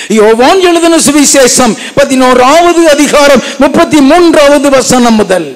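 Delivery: high, loud pulpit announcement; announcing the scripture. Acted. Preacher announcing the Gospel verse to the church, loud and high. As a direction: call out the verse to the hall.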